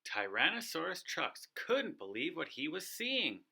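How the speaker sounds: usual speaking voice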